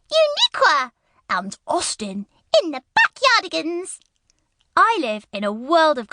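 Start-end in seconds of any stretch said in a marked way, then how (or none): 0.1-4.0 high cartoon voice. Shown as a demonstration. Voice reel of character voices.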